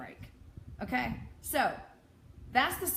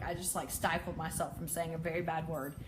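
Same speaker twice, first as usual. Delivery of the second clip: ordinary talk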